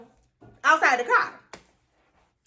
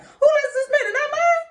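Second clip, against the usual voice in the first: high squeaky disbelief, laughing and half crying; incredulous disbelief; 6 dB louder than usual. Retold or imitated. Telling dad about the suspended license, incredulous high squeal. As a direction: squeal it like the news is absurd. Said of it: "my real reaction was like".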